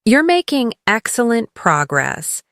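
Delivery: usual speaking voice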